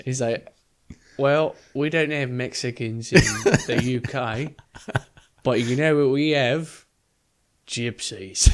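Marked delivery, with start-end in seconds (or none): 1.2-8.5 put-on British accent slipping into Australian; slow, botched British-Australian accent. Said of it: "This is just Australian".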